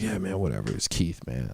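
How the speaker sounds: low, soft slurred mumble; drunken mumble. Retold or imitated. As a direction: mumble it half asleep. Too drunk, brushing off.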